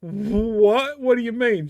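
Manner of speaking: high, startled What; confused, sharply surprised. Retold or imitated. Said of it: "And I'm like".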